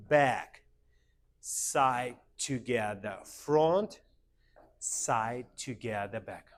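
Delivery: one drawn word per beat, easing off toward the end; rhythmic step calls. Shown as a demonstration. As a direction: call the moves in time. Teacher calls steps on the beat.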